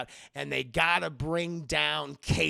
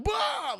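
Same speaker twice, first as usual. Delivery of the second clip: high sharp bah of fright; startled yelp; 4 dB louder than usual. Retold or imitated. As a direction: jump at the rat. Rat startles the priest.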